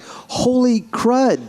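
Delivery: high, drawn-out amazement; amazed. Retold or imitated. Realising the singer took him for a Glaswegian, amazed. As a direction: stretch it out in astonishment. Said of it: "But I was like".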